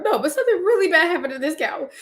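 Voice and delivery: high pleading. Retold or imitated. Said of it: "I was like".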